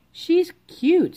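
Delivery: put-on sweet high girl voice; high girlish voice. Girl character voice.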